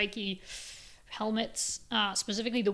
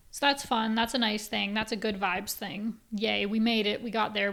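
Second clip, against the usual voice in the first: ordinary talk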